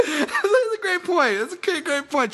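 real laugh bubbling through high-pitched words. Real, not acted. Cracking up at the Boston accent joke, laughing.

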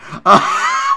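high, loud burst of laughter. Real, not acted. Host reacting to his own rant, loud laugh.

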